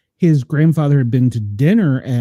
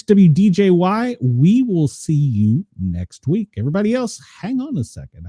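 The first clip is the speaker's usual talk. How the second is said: upbeat station ID read; radio announcer; 4 semitones higher than usual. Acted. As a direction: call it out like a DJ.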